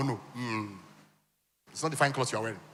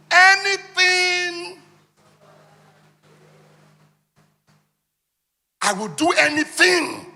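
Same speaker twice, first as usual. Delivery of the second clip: very high, loud and dragged out; hammed-up desperate plea; desperate cry; 13 semitones higher, 10 dB louder, much slower than usual. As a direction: wail it like someone who would do anything. Voicing a desperate poor person.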